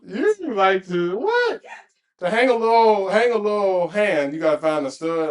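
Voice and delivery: loud, high, disbelieving; incredulous. Real, not acted. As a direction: scoff at the idea, loudly. Mocking disbelief at the stud advice.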